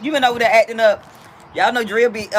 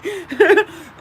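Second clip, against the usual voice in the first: high laugh; 12 semitones higher, 3 dB louder than usual. Real, not acted. Real burst of laughter on stream.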